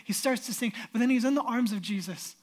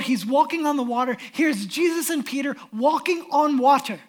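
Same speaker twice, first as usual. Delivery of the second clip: loud, higher, fervent sermon; impassioned preaching; 4 semitones higher, 8 dB louder than usual. Acted. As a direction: preach it full force with awe. Preaching at full force.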